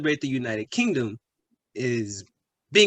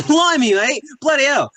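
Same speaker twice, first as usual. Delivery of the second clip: loud, high burst of indignation; raised-voice British exasperation; exasperated outburst; 8 semitones higher, 5 dB louder than usual. Acted. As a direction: exclaim it, loud and affronted. Acted exasperation at being called disgraceful.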